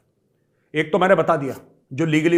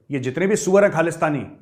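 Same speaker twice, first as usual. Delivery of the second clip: ordinary delivery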